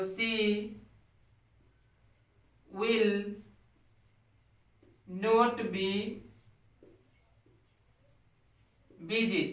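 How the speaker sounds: each word set apart by long pauses; slow word-by-word dictation. Shown as a demonstration. Teacher dictating to the class, word by word, slowly. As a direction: dictate it one word at a time for students to copy. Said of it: "teacher dictating word by word".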